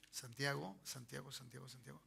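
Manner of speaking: low, fading mumble to oneself; very soft, distracted repetition; absent muttering. Real, not acted. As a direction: murmur it while searching for a page. Searching for the Bible passage.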